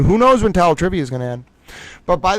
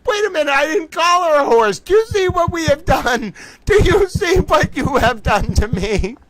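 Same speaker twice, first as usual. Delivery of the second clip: mock dramatic dismay; 12 semitones higher than usual. Real, not acted. Mock dramatic outrage at himself.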